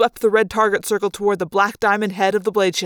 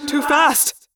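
urgent warning; 6 semitones higher than usual. Acted. Alien pilot warns of crash.

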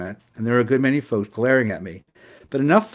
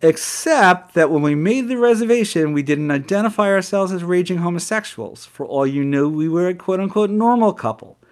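indignant retort; 6 semitones higher, 4 dB louder than usual. Retold or imitated. Quarrel punchline to manager.

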